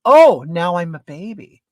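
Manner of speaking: offended comeback with a punched opening; indignant retort. Retold or imitated. Man retorts to the narrator in a quarrel, mock indignant. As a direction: throw it back, mildly affronted.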